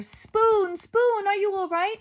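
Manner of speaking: high loud calling; cat rushes to hurt Spoon; worried calling. Acted. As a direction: call out anxiously.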